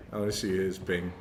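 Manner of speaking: ordinary delivery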